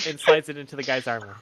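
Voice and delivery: ordinary talk, nothing marked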